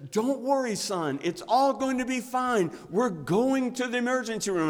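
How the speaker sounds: put-on high, loud soothing dad voice over a child's cries; reassuring father. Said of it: "he says".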